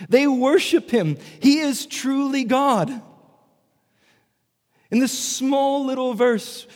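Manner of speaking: preaching at full force; rising fervor. Real, not acted. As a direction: build to the climax. Preacher's climax on two natures.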